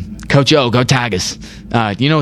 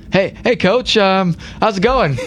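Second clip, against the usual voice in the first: higher, slowed, awkwardly polite player voice; nervous player greeting coach; 6 semitones higher, slower than usual. Pretend. Player nervously greeting his girlfriend's coach father, higher and slow. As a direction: play the sheepish boyfriend greeting her coach father.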